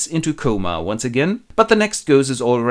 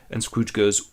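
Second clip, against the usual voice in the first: usual speaking voice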